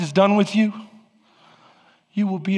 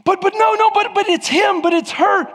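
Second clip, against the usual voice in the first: mock whiny deflection; 12 semitones higher than usual. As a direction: blame someone else, petulantly.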